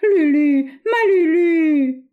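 loud, high, long-drawn wail; pleading. A narrator's reading. Bois d'Anguin on his knees to Lucette, wailing plea. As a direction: wail the pet name, stretching it out.

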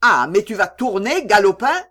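put-on loud, angry threat to a stubborn boy. A narrator's reading. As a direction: bark it down at the boy. Oncle menace l'enfant. (oncle menaçant, voix forte et en colère)

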